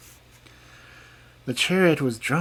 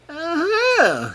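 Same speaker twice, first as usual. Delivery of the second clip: much higher fairy oh; 6 dB louder than usual. A narrator's reading. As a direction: halt him smugly. Fairy stops the king.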